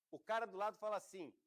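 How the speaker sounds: usual speaking voice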